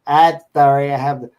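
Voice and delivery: mimicked old lady caller; weird old lady. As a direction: launch into the odd old woman's stock apology. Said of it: "It's the same old lady".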